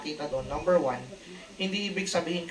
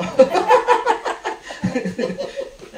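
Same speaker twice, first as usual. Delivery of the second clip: loud laugh; 10 dB louder than usual. Real, not acted. Preacher laughs at own joke.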